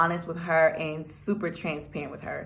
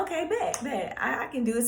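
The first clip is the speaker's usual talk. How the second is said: higher, with a laugh through the words; laughing agreement; 5 semitones higher, 5 dB quieter than usual. Retold or imitated. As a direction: giggle it out, relieved.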